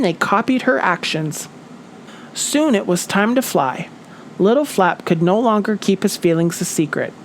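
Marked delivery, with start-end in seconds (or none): none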